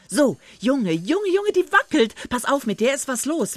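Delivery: high, loud rush of excitement; worked-up, high-pitched exclaiming; excited boy. A narrator's reading. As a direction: call out a thrilling find to your companion. Willi spots the wobbling fish trap.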